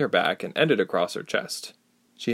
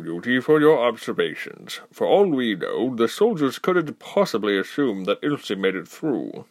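lightly voiced older leader, measured and grave; grave council spokesman; 4 semitones higher than usual. Spokesman warning Ilse about the wall, lightly voiced and grave. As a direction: weigh each word like an elder.

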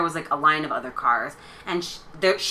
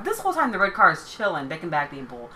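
usual speaking voice

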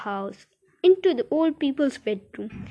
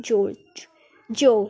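ordinary delivery

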